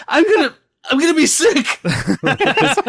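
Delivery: high, strained, queasy squeak; nauseated panic. Acted. Byron watching his friend burn, high queasy panic. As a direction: voice shooting up in sick panic. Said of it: "having a nervous breakdown".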